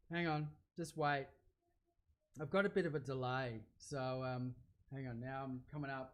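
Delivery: ordinary talk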